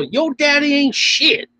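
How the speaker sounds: high, slow mocking yell of "Yo, daddy ain't shit"; hammed-up mama yell; mock yelling mom. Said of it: "I like when they yell".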